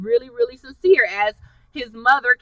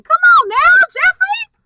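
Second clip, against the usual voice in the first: much higher, pleading scold at Jeffrey; exasperated plea; 5 dB louder than usual. Real, not acted. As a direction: beg him like he's blowing it. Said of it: "scolding the character, pitch leaps".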